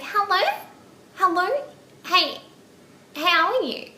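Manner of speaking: high squeaky elfin phone voice; Dobby the elf. Retold or imitated. Date greeting her on the phone, squeaky elf impression. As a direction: imitate a squeaky elf saying hello. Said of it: "sounded like Dobby the elf".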